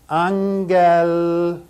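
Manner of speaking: Angelion drawn out slowly, syllable by syllable; slow Greek word. Shown as a demonstration. Teacher slowly modeling a long Greek word. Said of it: "Here's a long word".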